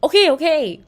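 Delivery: startled. A narrator's reading. Claire startled, complying.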